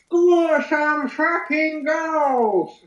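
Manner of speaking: high, very slow shouted chant; English football fan. Acted. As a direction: bellow it like a terrace fan.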